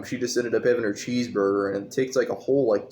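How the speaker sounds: ordinary talk, nothing marked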